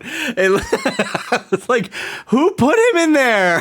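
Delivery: talking through giggles; words broken by real laughter; laughing. Real, not acted. Laughing at kid in cage.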